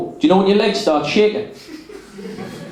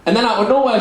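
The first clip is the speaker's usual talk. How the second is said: ordinary talk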